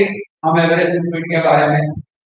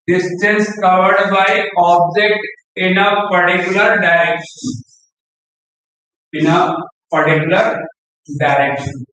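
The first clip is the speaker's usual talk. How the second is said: slow dictation; much slower than usual. Shown as a demonstration. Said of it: "teacher dictating definition".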